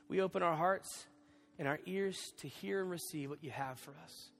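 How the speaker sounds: hushed, reverent pastor's prayer; quiet congregational prayer, gently led; soft prayer voice. Acted. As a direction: pray softly over the room. Leads the opening prayer.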